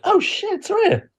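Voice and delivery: high-pitched startled gasp of a name; suddenly spotting a famous wrestler; surprised. Retold or imitated. Fans spot the wrestler, high startled surprise.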